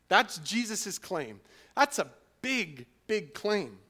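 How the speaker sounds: loud, high preaching voice; full-force preaching. Acted. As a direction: proclaim it to the whole church.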